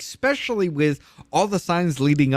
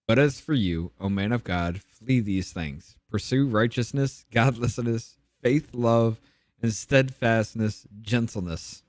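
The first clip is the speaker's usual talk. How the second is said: slow, solemn Bible reading, a bit lower; scripture reading; 4 semitones lower than usual. Acted. Reading First Timothy aloud to the group, slow and solemn. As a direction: read the verse like a sermon passage.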